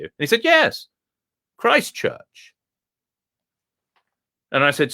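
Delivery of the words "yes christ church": a high, plummy Etonian dog, pleased with itself; the dog boasts in a high, refined voice; posh dog voice. Acted. Telling the visitor about his schooling, high and plummy. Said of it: "speak like this".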